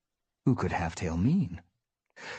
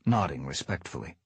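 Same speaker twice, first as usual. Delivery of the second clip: ordinary delivery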